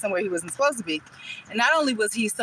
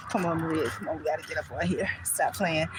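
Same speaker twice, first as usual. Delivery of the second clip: low, soft talking to oneself; muttered self-urging; 5 semitones lower, 7 dB quieter than usual. Real, not acted. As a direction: scold yourself along under your breath. Urging oneself on while walking bike.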